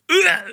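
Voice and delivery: high-pitched recoiling ugh; mock gag at a strong drink; disgusted ugh. Retold sip of straight gin.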